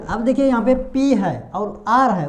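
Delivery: ordinary talk, nothing marked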